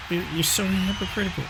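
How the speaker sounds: ordinary talk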